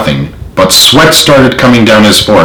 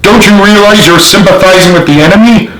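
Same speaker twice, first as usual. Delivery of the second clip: higher, accusing and furious; fuming; 9 semitones higher than usual. A narrator's reading. General accusing the sergeant, fuming and higher. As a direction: accuse him of siding with the enemy. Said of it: "he fumed".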